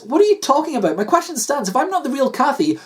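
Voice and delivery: high, exasperated woman character; demanding Kathy. Acted. Wife repeating her question to husband, exasperated.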